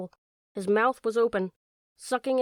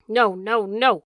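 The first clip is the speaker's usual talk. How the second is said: loud excited refusal; 7 dB louder, slower than usual. A narrator's reading. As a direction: cut him off with loud cries. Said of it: "the dog said excitedly".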